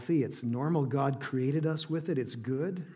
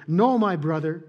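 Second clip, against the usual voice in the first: louder plea; cried out; 3 semitones higher, 8 dB louder than usual. Acted. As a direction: cry out to her brother. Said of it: "she cried".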